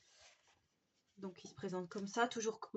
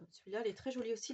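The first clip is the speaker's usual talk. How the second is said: ordinary talk, nothing marked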